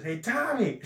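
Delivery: high-pitched retold call; shouting across party. Dickie hails Tommy across the party, mock shouting. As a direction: imitate hailing a friend over a crowd. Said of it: "Why are they shouting across the party?".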